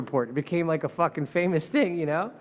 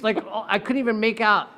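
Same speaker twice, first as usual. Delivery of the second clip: usual speaking voice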